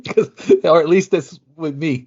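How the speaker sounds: laughing through the words at his own joke; amused, laughing aside. Real, not acted. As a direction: chuckle while admitting it's just you.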